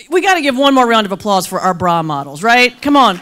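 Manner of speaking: ordinary talk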